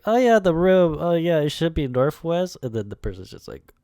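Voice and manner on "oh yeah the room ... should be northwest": high, booming mock call; loud character voice. Storyboard character directing someone to the room, high loud mock shout. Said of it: "he's just like".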